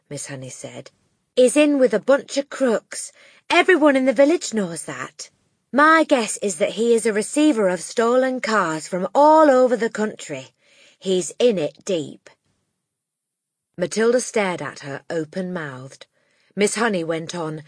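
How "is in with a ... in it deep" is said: grave teacher. Acted. Miss Honey explains.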